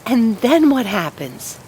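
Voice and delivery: can barely wait to hear; excited fir tree. Acted. Fir tree pressing the sparrows, eager and high. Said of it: "so excited it began to shake".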